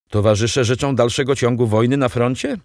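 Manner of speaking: plain reading voice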